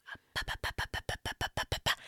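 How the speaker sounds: voiceless rapid whispered syllables; imitated whispering. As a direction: mimic secretive whispering in someone's ear. Imitates waiter whispering to bartender.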